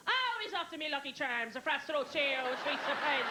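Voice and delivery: hammed-up advert-leprechaun Irish; mock Irish brogue. Friends phone to mock father, exaggerated Irish brogue. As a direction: tease with an exaggerated Irish lilt. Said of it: "my friend used to make fun of him".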